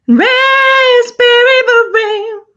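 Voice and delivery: very high, slow falsetto [name]. Retold or imitated. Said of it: "Have you ever heard [name] talk?".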